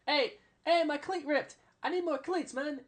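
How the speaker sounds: very high mimicked player voice; calling out. Player calling for new cleats, high urgent mock call.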